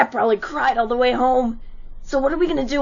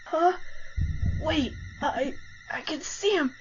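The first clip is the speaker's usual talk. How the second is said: much softer, frozen with fear; terrified hush; 9 dB quieter than usual. Acted. Blinded bully seeing the monster, hushed. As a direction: breathe the words out in dread.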